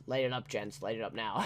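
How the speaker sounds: soft, low half-hearted repeat of the order; muttered retake. Retold or imitated. Redoing the villain's line, a soft low half-hearted mutter. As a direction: mumble it, giving up on the impression. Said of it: "It's not as good".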